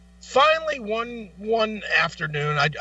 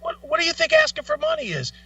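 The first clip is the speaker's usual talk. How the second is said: much higher, disbelieving retort; incredulous. Retold or imitated. Storyteller answering the angry homeless man, incredulous and much higher. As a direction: sound stunned at the nerve. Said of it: "I was like".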